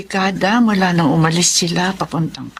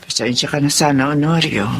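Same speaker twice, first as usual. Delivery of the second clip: put-on old woman voice; 7 semitones lower than usual. Old mother apologizing to son.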